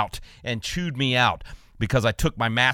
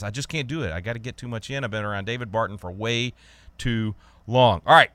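ordinary talk, nothing marked

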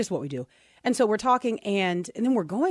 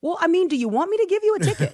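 high, fast, louder imitated question; incredulous officer; 10 semitones higher, 5 dB louder, faster than usual. Officer asking the driver about a ticket, incredulous. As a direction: play the officer baffled by her confession.

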